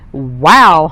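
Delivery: loud goose honk of wonder. Acted. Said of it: "hunked Goosey Gander".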